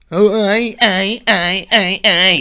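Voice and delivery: high loud mocking stutter; mock stammer. Mirror self mimicking Mars stammering, high and loud. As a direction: parody his stammering.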